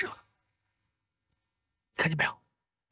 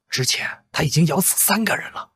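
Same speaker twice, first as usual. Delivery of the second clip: usual speaking voice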